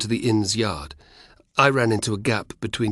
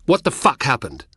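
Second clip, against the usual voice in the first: angry; 5 semitones higher, 4 dB louder than usual. Acted. Spartacus rounds on friends.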